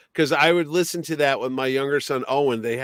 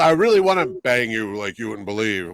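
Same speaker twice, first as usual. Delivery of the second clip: mock Superman voice; 3 semitones lower, 6 dB louder than usual. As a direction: lower hammed-up hero voice. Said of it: "Why did your voice change".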